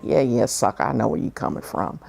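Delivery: under your breath, you can't fool me; knowing inner scoff. Retold or imitated. She answers the agent in her head, low and scoffing. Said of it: "And like".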